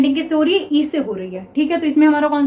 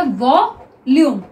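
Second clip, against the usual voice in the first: Volume said slowly; slow drawn-out reading; 3 dB louder, much slower than usual. Shown as a demonstration. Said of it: "तो ये क्या हो गया". (Volume धीरे से)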